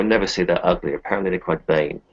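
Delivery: normal talking voice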